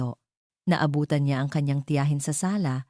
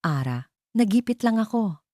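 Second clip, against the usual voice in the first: sobbing plea; 6 semitones higher than usual. Acted.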